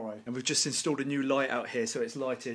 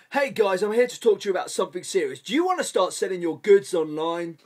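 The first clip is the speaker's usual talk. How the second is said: loud, fast, cheesy presenter pitch; salesman pitch voice; 5 semitones higher, 10 dB louder than usual. Pretend. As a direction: sell it like a cheap online ad. Mock sales pitch to camera.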